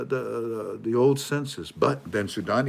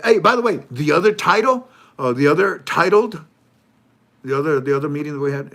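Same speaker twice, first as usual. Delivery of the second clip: lightly high, loud put-on official voice; mock official; 5 semitones higher, 7 dB louder than usual. Mimicking the official recounting his meeting, high and loud. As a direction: play the self-important official.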